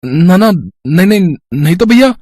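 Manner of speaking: high, flustered repeated no; stammering denial. Acted. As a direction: deny it too quickly, tripping over the words. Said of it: "अकला क्यों रहे हो".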